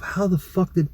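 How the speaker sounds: high-pitched, baffled self-questioning; retold alarmed bafflement. Man thinking to himself about what he told her, baffled alarm.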